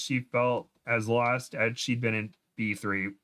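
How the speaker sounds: plain reading voice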